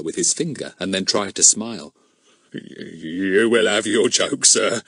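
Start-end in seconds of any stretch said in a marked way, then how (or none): 2.5-4.8 slow nervous trembling. Acted. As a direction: shake with nerves. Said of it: "in a rather trembling voice".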